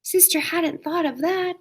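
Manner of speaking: ordinary delivery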